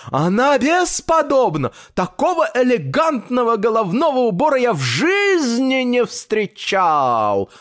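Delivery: high, rapturous tailor, drawing out the end; joyful raving. A narrator's reading. Tailor praises grandfather's hat, joyful raving. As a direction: gush with delight in a high voice. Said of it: "радостно воскликнул портной".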